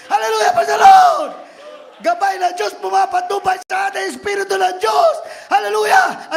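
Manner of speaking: loud, high hallelujah shout; preaching at full force. Acted. Preacher leading praise, shouting. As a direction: lift the crowd in praise.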